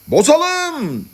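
high, drawn-out cry; crowd shouting. A narrator's reading. Madmen answering their speaker, shouting assent. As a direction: shout it as one eager mob. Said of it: "diye bağırdılar".

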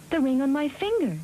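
high-pitched storybook maiden; reply high, as the miller's daughter. A narrator's reading. Maiden offers her ring to the little man, high-voiced. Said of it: "she replied".

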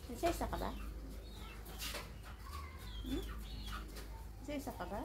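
tender hushed teasing. Real, not acted. Baby talk to pet.

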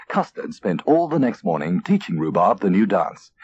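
ordinary delivery, nothing marked

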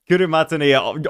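usual speaking voice

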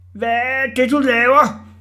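high, very loud yell; screamed question. A narrator's reading. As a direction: scream it up in outrage. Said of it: "skreg Orla".

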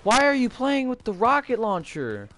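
high, loud indignant wail; exasperated yell. Real, not acted. Player to teammate with rocket launcher, exasperated yell. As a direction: demand it in loud disbelief. Scolding a teammate mid-game.

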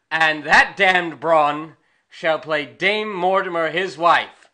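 normal talking voice

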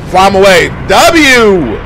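loud, high celebratory shout; triumphant cheer. Real, not acted. Fan cheering as he is flown away, triumphant shout. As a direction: holler it like a win.

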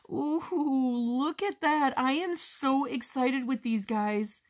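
high, slow, delighted gush; giddy high excitement; thrilled. Real, not acted. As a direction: gush over the new paper, drawn out and up high. Showing off the new paper.

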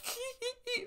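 a sudden very high, short cackle; high-pitched cackle the barber always did; imitated high cackle.

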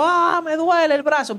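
high-pitched wail of pain; acted tearful whine; imitated crying. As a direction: cry out as if hurt, retold. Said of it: "Y estoy llorando".

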